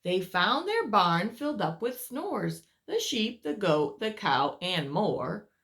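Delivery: lively read-aloud voice for little ones; storyteller reading to children; story narration. A narrator's reading. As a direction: tell it like a bedtime picture book. Reads picture book aloud.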